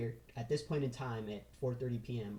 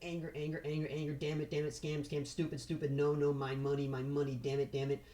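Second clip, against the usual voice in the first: higher, frantic mock rant of an angry trader; repeated no and damn it, pitched up in mock frustration; 3 semitones higher than usual. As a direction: act out the losing trader cursing his luck. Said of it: "and he's like".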